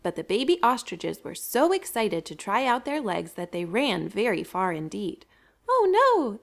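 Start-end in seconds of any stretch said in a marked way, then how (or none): 5.7-6.4 high, squeaky panicked chicks; chicks crying out. A narrator's reading. Lost baby chicks crying out in alarm. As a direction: cry out like lost little chicks. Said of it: "they cried".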